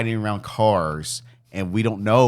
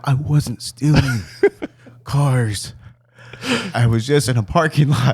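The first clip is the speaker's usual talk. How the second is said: exhausted; 3 semitones higher, slower than usual. Retold or imitated. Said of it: "I'm exhausted".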